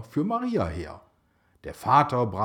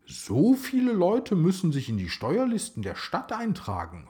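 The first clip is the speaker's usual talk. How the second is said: put-on a higher, wide-eyed boy's amazement; amazed; 8 semitones higher than usual. A narrator's reading. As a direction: marvel at the crowds, pitched up. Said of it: "staunte Kaleb".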